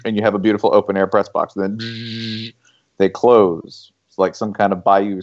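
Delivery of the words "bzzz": soft drawn-out machine buzz; long, quiet bzzz sound effect; imitated motor buzz. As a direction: mimic a powered window whirring shut. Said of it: "it goes bzzz".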